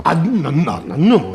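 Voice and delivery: loud pleading "non, non"; panicked pleading. Acted. Witness begs the policeman not to jail him. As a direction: beg not to be taken in. Threatened with prison.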